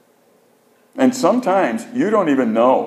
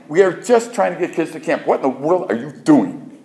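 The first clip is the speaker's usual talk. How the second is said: higher-pitched exasperated protest; retold mild yelling; 6 semitones higher than usual. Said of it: "was yell at him a little bit".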